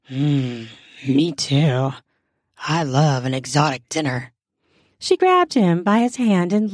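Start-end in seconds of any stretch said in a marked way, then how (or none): 0.1-4.3 deep, low flirting growl; seductive growl. A narrator's reading. Husband flirts with wife over dinner, growling seductively. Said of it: "growled seductively at her".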